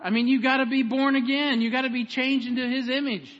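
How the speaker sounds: emphatic preaching. Real, not acted. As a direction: press the point home to the congregation. Preaches at full force.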